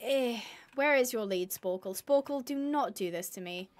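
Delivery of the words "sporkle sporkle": normal talking voice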